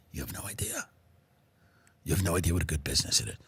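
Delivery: hushed, secretive whisper; conspiratorial whisper. Acted. Rich trafficker whispering to a fellow guest, conspiratorial. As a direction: lean in and whisper it like a shared secret.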